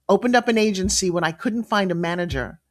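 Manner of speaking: ordinary delivery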